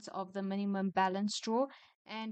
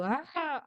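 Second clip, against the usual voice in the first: mock scream; 6 semitones higher, 5 dB louder than usual. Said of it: "normally when I'm all".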